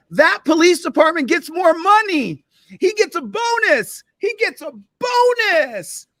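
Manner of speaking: high, slow mocking sing-song. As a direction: sneer it like a cartoon villain. Mocking cops' reward system.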